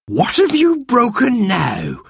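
high, drawn-out scolding; cross scolding. A narrator's reading. Martha scolds the child who broke something, cross. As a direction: scold with exasperation, pitched right up.

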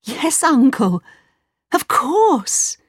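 put-on high, delighted boy's reply; happy. Aladdin agrees to take his new uncle home, happy. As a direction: agree gladly with the pitch lifted. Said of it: "said Aladdin happily".

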